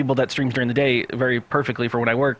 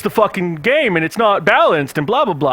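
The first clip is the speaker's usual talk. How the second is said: loud, higher-pitched mock protest; mocking a complainer; 6 semitones higher, 5 dB louder than usual. Organizer parodying players who fuss over balance, mocking protest. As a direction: imitate a rules nitpicker, fading into dismissal. Mocks nitpickers of tournament rules.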